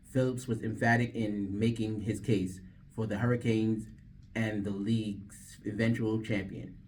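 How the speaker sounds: sports news read. Real, not acted. DJ redoing the sports read, announcer voice.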